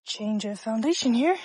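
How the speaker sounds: breathing hard while hiking; winded from the incline; heavy breathing. Real, not acted. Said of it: "Pardon my breathing".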